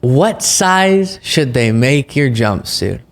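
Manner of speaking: drawn-out quote, about half speed; slow, deliberate repeat. Retold or imitated. Host repeating the clip's punchline to viewers, slow and deliberate. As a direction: stretch each word out to let the line land.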